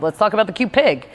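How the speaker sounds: ordinary talk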